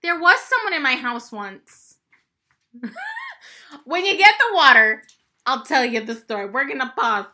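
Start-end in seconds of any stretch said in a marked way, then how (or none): 2.8-3.3 high laugh. Real, not acted. Laughs about the intruder.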